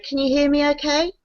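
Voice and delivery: ordinary talk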